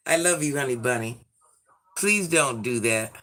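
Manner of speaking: normal talking voice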